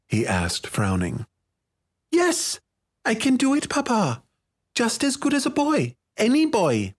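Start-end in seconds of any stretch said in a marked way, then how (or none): 2.1-6.9 put-on high, insistent child's voice; scowling little girl. A narrator's reading. Daughter insisting to Papa she can do it, scowling and high-pitched. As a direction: protest in a much higher girlish voice. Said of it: "She scowled at Forzana".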